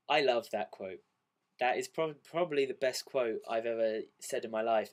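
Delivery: usual speaking voice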